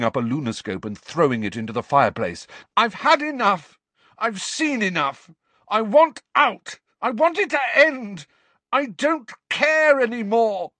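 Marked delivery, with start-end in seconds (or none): 2.8-10.7 much higher and louder, furious and desperate; raging outburst. A narrator's reading. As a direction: scream out every grievance. Harry raging in the office.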